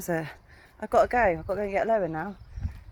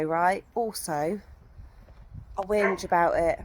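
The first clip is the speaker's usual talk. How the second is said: normal talking voice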